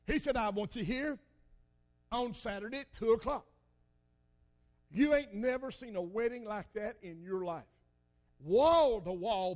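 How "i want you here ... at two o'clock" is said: ordinary talk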